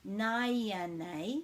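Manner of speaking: naya nei with the long first syllable leaned on; stress on naya. Shown as a demonstration. Teacher showing where the stress falls in naya nei. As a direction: lean into the start of naya. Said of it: "the emphasis is on the, this beginning bit".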